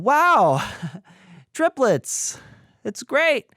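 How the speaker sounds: high-pitched stunned wow with a laugh; forced delight. Retold or imitated. Father just told of triplets, high forced delight. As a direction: act overwhelmed while claiming delight.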